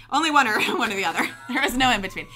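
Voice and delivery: loud, high, amused burst; laughing. Real, not acted. Guest joking to interviewer about her risky host, laughing loudly. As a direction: laugh through your own punchline. Laughing at own serial-killer joke.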